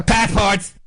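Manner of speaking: high, clipped one-word order; bossy guard bark. Retold or imitated. Customs guard barking at the queue, high and sharp. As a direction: snap it like an impatient guard.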